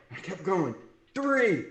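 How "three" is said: called-out count. Retold or imitated. Counting reps as judge in story.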